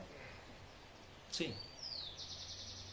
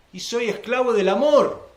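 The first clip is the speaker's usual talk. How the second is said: higher and louder, a triumphant cry; loud proclamation; 9 semitones higher, 14 dB louder than usual. Real, not acted. Preacher crying out to the congregation about love. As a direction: proclaim it at full force.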